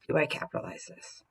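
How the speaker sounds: "Do I capitalize this?" whispered softly under the breath; soft whispered self-check. Shown as a demonstration. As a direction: murmur the question privately to yourself. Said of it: "Is it one of my mints?".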